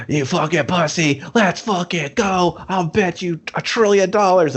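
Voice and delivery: high, strained mock taunting; goading challenger. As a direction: play a hammed-up rival demanding a bet. Acting out challenger goading Hawk.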